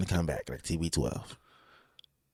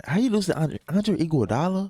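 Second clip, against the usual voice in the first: high, teasing disbelief; mocking disbelief; 9 semitones higher than usual. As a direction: rub it in with mock shock. Mocking Steph Curry's finals loss.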